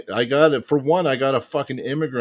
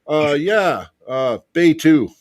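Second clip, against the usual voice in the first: higher, louder mock official, drawn-out words; imitated border officer; 6 semitones higher, 6 dB louder, slower than usual. Border officer sending returning traveller for inspection, mock officious. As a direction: mimic an officer ordering someone aside.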